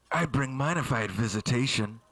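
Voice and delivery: low, defeated aside; low depressed aside. Acted. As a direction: drop low, mumble the sad truth.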